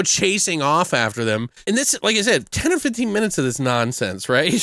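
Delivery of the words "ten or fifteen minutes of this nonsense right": ordinary talk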